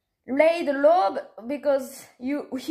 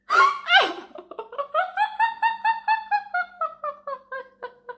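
long, high-pitched laughing fit; helpless laughter. Real, not acted. Helpless at the Italian tag mistake.

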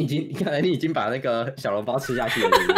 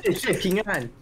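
fake ABC accent; 3 semitones higher, slower than usual. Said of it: "那种假A B C".

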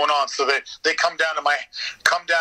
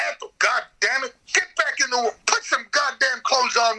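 high, mock-angry hillbilly holler; hillbilly yelling at wife; 7 semitones higher than usual.

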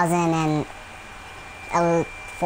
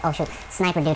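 ordinary talk, nothing marked

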